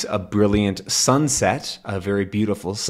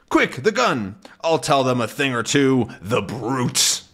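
urgent threat; 3 semitones higher than usual. Acted. Haddock threatening the sharks, urgent. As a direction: make it a fighting threat.

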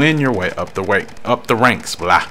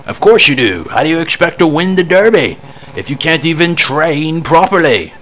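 scolding trainer; 7 semitones higher, 5 dB louder than usual. Acted.

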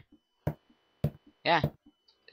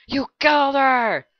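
high panicked cries; 11 semitones higher, 6 dB louder than usual. Acted. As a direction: scream it in terror. Panicked character voice.

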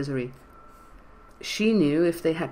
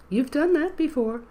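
much higher, frail granny voice; old woman voice; 12 semitones higher than usual. A narrator's reading. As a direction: speak like a quavery old lady. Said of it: "in his old woman voice".

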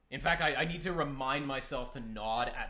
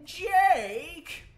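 much higher, louder drawn-out sing-song call; high teasing call; 11 dB louder than usual. Pretend. Streamer calling after fleeing survivor Jake, loud high teasing call. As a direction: holler his name like calling a lost pet. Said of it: "All right, I'll stop".

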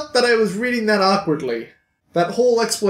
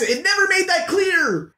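exasperated outburst; 9 semitones higher than usual. Real, not acted. Ranting about unclear ending.

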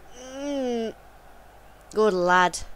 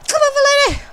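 much higher and louder come on; urgent cheering; 11 semitones higher, 9 dB louder, faster than usual. Real, not acted. Gamer cheering a counterattack, high and loud. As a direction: drive your players forward. Urging the attack.

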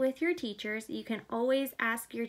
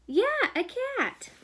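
much higher, delighted; excited answer; 12 semitones higher, 5 dB louder, slower than usual. Real, not acted. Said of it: "What animal makes the meow".

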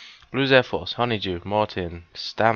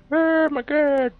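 high, slow delighted oh my god; drawn out in delight; excited gasp; 3 dB louder, slower than usual. Real, not acted. Finds presents.

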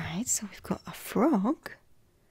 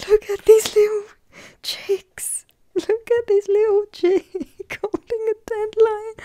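gushing over cute; 13 semitones higher, 8 dB louder than usual. Real, not acted. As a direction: squeal over the tiny chicks. Gushing over cute chick card.

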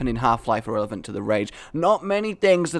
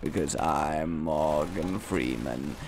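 poor impression of a low-voiced documentary narrator; Morgan Freeman; 9 semitones lower, 6 dB quieter than usual. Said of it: "this is the worst Morgan Freeman impression".

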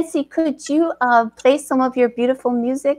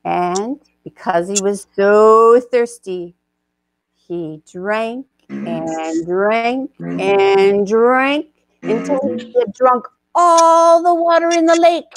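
lively storybook narration; story narration. A narrator's reading. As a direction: tell it like a picture book read aloud.

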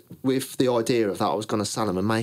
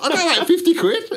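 high, loud laughing confession; laughing at being broke; 9 dB louder, faster than usual. Real, not acted. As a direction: blurt it out through laughter at yourself. Laughing confession of having no money.

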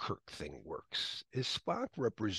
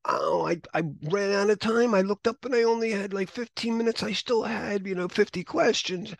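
higher, louder panicked student; student tells Larry they ran out of time, panicked and higher; 10 semitones higher, 7 dB louder than usual. Retold or imitated. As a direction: play the student who ran out of time, rushed and upset. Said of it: "they go".

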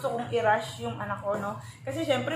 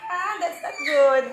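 laughing yelp; 4 semitones higher, 6 dB louder, slower than usual. Real, not acted. Baby pulls hair, pained laugh.

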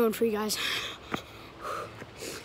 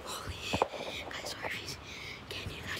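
whispering; 11 dB quieter than usual. Real, not acted. Hiding from a homeowner.

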